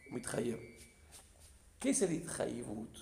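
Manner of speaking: usual speaking voice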